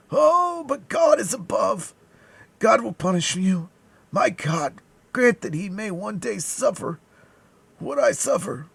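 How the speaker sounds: high, wailing curse; agonized dying man. A narrator's reading. Dying man cursing his poisoner, high agonized cry. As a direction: call down judgment from your agony. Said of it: "a dying man were distorted with agony".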